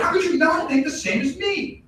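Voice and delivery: high, exasperated yelled question; high-pitched mock outrage rising at the end; outraged shout. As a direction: cry it out in mock indignation. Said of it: "and you're like".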